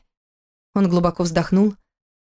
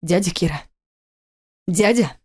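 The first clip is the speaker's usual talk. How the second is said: hissed. Acted. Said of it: "прошипел".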